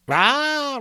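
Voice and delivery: high laughing exclamation. Acted. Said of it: "laughing and leaning forward".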